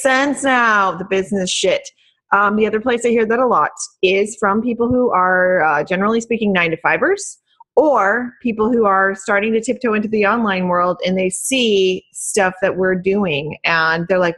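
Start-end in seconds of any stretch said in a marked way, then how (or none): none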